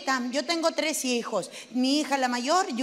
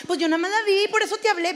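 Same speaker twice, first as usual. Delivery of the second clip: defensive daughter; 6 semitones higher, 5 dB louder, faster than usual. Retold or imitated. Daughter's high-pitched excuse.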